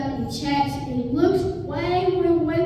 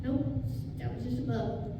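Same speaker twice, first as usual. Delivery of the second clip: much softer, fast mutter to himself; soft, quick self-talk, second-guessing; 11 dB quieter, faster than usual. Retold or imitated. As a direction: squint at the horizon and murmur under your breath. Servant squinting at horizon, muttering.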